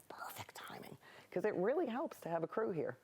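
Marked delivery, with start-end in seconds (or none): 0.1-1.0 hushed, whispered reply; whispered aside. Retold or imitated. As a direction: whisper it under your breath, much softer. Retelling reply to time cue.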